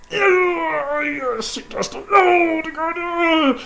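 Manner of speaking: high, loud strained pleading; pained pleading. Acted. Sindre pleading with Brok while the fly bites. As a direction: beg him to hold on through the pain.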